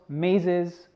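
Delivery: ordinary delivery, nothing marked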